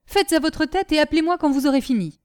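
high, loud, quick dismissal; out of patience. A narrator's reading. Brigadier leaving Nanon to get on with it, exasperated. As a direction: give up on her in exasperation. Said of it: "à bout de patience".

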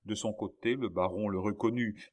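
plain reading voice

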